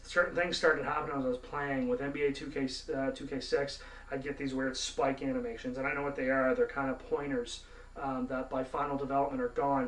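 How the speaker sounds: ordinary talk, nothing marked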